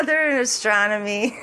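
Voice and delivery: giggling through the words; laughing at herself. Real, not acted. Vlogger fumbling an astronomy word, laughing. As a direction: laugh at your own stumble. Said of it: "I can't even say it".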